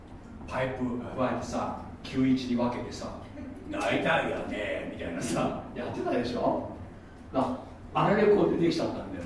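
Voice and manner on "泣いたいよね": light impression, low and drawled; slow, low mimicry of a pipe-smoking pundit; Kenichi Takemura. Recalling Takemura with his pipe, slow low impression. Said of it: "竹村健一".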